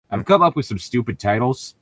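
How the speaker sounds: ordinary talk, nothing marked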